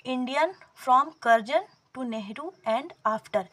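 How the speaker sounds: every word of the title drawn out slowly; slow title reading. Shown as a demonstration. Teacher introduces the book to exam aspirants, slowly and deliberately. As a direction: dictate the title to the class.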